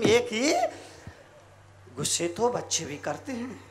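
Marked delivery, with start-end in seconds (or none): 2.0-3.6 low, soft delivery; understated and low; quiet aside. Shown as a demonstration. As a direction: say it softly, pitched well down. Soft first take, then shouted repeat.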